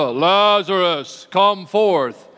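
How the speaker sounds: drawn-out, booming call with authority; loud commanding summons. Shown as a demonstration. Preacher shows how Jesus called Lazarus from the tomb, loud and commanding. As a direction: summon him loudly, stretching the name. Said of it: "it was a loud voice".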